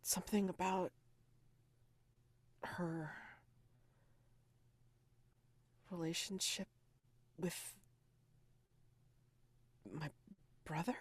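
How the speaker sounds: very soft, with long gaps between words; faint, halting murmur. Acted. Radish opens her eyes and questions Pan, faint and halting. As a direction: barely get the words out, piece by piece.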